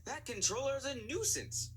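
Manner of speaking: high, indignant twin engines; cried out. A narrator's reading. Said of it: "cried out Donald and Douglas".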